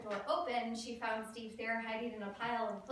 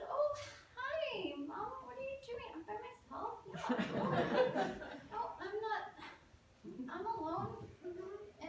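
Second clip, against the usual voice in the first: stuttering, nervous; 10 semitones higher than usual. Acted. Teen covering up to mother in kitchen, nervously innocent. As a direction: play it innocent in a small high voice, stalling. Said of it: "I was stuttering and shaking".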